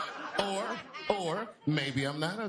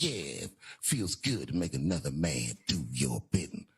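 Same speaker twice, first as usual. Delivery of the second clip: Puff; 11 semitones lower than usual. Acted. Caught man answering the intruder, deep self-satisfied voice. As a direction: drop deep and savour it. Same acted answer, replayed.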